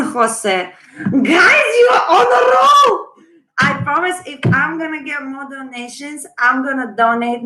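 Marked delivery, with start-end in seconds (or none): 1.2-3.1 high, loud delighted cheer; thrilled shout to the viewers; excited shout. Real, not acted. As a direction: burst out thrilled at the donations.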